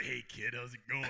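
low, soft driver voice; quiet, low mock driver; creepy driver. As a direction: play the driver low and casual. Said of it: "the driver's like".

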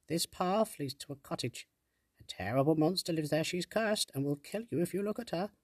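high, ominous little sprite; fairy sprite voice. Acted. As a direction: warn like a frightened fairy. Sprite warns of monster.

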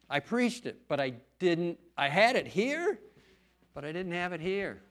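preacher driving the point about head and heart; emphatic preaching. Real, not acted. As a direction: hammer the contrast home from the pulpit. Preacher stressing head versus heart.

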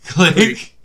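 a sharp, high mock click of a hang-up; mouth click noise. Friends retelling the call, mimicking the hang-up click. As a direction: voice the phone hanging up, loud and high. Imitating the phone hanging up.

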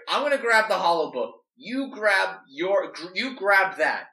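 high, loud, slowed orders; high loud commanding thief; bossy Shark. Acted. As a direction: bark the plan at Doll.

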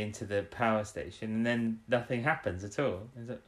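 ordinary talk, nothing marked

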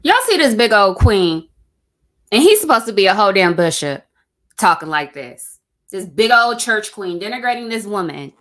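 loud disgusted outrage at the bishop; outraged rant. Real, not acted. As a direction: blast him for how he talks. Outraged commentary on bishop's clip.

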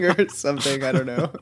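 normal talking voice

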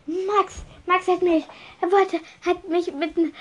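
high, halting accusation; high voice tripping over its words; stammering. A narrator's reading. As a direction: stammer out the blame. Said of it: "stotterte Draco".

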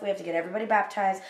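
ordinary talk, nothing marked

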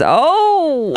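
high shouted vaudeville whoop; hammy 1960s comic yell; old borscht belt comic. Acted. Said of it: "I love your impression of".

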